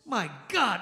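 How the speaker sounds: ordinary talk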